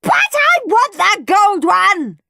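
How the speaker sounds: high, loud, drawn-out tantrum; screaming. A narrator's reading. Henry to Mum after being told to wait, screaming. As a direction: scream it, pounding every word. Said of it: "screamed Henry".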